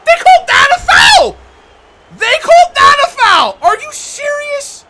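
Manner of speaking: high, very loud protest at the call; outraged shout. Real, not acted. Gamer protesting a foul call, outraged shouting. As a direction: yell in disbelief at the referee.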